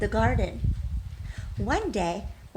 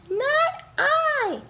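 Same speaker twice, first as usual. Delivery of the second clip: tiny mouse squeak, much higher and louder; squeaky mouse; 5 dB louder than usual. Acted. Mouse's refusal in the story.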